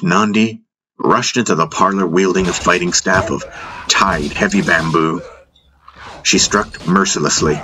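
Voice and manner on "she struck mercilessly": usual speaking voice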